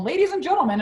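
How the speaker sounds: high announcer voice over the store phone; store PA announcement. Retold or imitated. Mop seller announcing on the store phone, high announcer call. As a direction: call the whole store to the stage. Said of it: "I do them in French and English".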